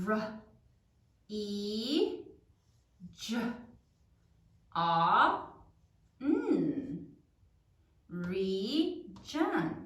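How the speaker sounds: R, E, J, A, N low, with long pauses; sounding out while writing. Shown as a demonstration. Teacher writes along with class, sounding out. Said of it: "Now say it as you write it".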